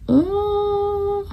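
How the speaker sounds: high, soft, held "oh"; drawn-out high "oh". Retold or imitated. Reacting to a pregnancy dream, high drawn-out "oh". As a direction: stretch it out high and quiet.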